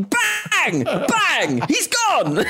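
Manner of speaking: high, hammed-up shouted bangs; mock shout. Playing the dad scaring off the monster, high hammed-up shouting.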